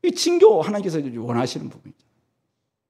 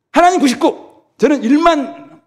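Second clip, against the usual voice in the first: higher, louder, slow stressed numbers; emphatic exclaiming; 9 semitones higher, 4 dB louder, slower than usual. Real, not acted. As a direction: spell out the odds with emphasis. Emphatic bargaining. (강조하며 높여 외치기)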